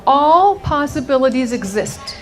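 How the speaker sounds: normal talking voice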